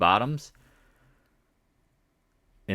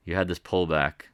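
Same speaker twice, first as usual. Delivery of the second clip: ordinary delivery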